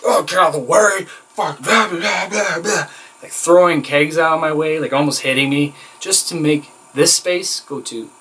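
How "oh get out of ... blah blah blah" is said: high, mocking imitation of a ranting trainer; angry trainer rant. Trainer shoving trainee aside from kegs, angry high-pitched rant. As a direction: rant crossly, dissolving into mock gibberish. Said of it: "and then he's like".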